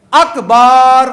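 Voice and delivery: اکبر said as akbaar with a long vowel between ba and ra; extra alif in akbar. Shown as a demonstration. Teacher demonstrating the wrong inserted alif to the congregation. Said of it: "با اور را کے درمیان الف کو بل-، بڑھا دینا۔".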